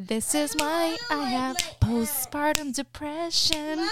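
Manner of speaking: slow, drawn-out exasperation; exasperated lament. Real, not acted. Mother blaming the children for her depression, slow exasperated lament. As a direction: stretch each word out in exasperation.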